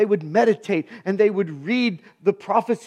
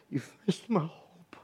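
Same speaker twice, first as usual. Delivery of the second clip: whisper; 6 semitones lower, 12 dB quieter than usual. Real, not acted.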